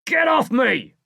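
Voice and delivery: high, loud furious yell; a trapped man's angry shout. A narrator's reading. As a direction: roar at the boys on top of him. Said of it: "shouts the bigger man".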